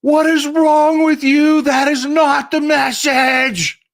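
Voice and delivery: mocking Republican colleagues' indignant shout at Lisa Murkowski; mock scandalised Republicans. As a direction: play the appalled party scolding her. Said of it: "and they're like".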